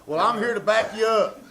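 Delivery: usual speaking voice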